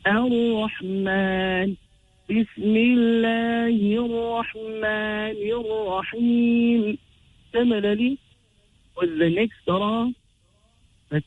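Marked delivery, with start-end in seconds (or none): 0.0-7.0 Ar-Rahman with a long held maan, slowly chanted; pulled mad. Shown as a demonstration. Teacher showing the mad, Ar-Rahman slowly pulled. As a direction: recite Bismillahir Rahmanir Rahim, drawing out the long vowels. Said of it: "we should pull it twice".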